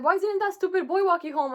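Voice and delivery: dad scolding over the absent boy, lightly voiced annoyance; annoyed dad. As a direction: voice the dad grumbling about the boy.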